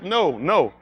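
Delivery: ordinary delivery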